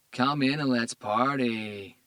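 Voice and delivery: dopey woman inviting cops to party; Spanish-accented woman. A narrator's reading. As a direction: stretch it out lazily, Spanish accent. Stoned woman voiced.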